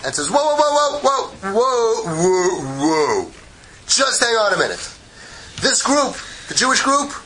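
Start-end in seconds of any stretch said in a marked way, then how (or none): 0.3-3.3 alarmed objector. Acted. Egypt's angel interrupts God, alarmed mock protest. 3.9-7.1 lightly voiced protest, pitched high; objecting angel. Egypt's angel argues with God, high mock protest.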